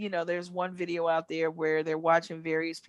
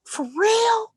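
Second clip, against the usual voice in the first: much higher, amazed "For real?"; surprised question; 4 dB louder than usual. Retold or imitated. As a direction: imitate him caught off guard. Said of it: "he's like".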